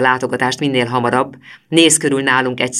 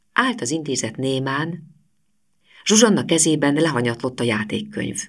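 ordinary delivery

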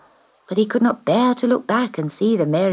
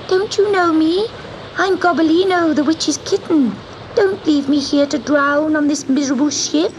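high, desperate little cat; pleading kitten voice; 10 semitones higher than usual. Acted. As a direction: beg to be rescued, small and scared.

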